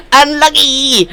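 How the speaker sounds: high, drawn-out mock of his accent on Unlucky; a playful parody of his accent saying Unlucky; imitating his accent. Streamer mimics a viewer's accent to chat, playful accent parody. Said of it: "I always hear it in his voice".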